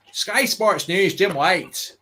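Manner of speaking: mock Scottish sports presenter; Scottish accent. Shown as a demonstration. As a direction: announce it in a put-on Scottish accent. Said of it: "it's like Scotland. I have to start".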